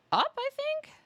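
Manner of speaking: squeaky, loud, unsure; high unsure squeak. Real, not acted.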